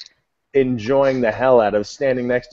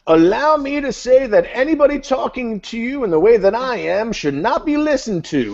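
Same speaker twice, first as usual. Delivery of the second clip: high, declaiming sermon voice; hammed-up high-pitched oratory; mock street preacher; 13 semitones higher than usual. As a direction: proclaim it grandly to passers-by. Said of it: "that would be the total approach. It would be like".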